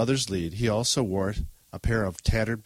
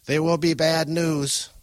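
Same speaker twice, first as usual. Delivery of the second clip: slow, high, louder warning; ominous old woman; 7 semitones higher, 5 dB louder, slower than usual. Acted. Grandmother warning her grandson, slow and ominous. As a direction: foretell disaster in an old woman's voice. Grandma announces a bad omen.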